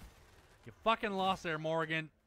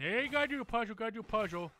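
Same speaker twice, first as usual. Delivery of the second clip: mocking quote; 5 semitones higher, faster than usual. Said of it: "I hate when people say".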